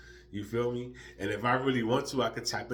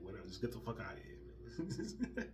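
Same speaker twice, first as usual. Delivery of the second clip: dismissive mutter; 12 dB quieter, faster than usual. Retold or imitated. Dismissive inner thought.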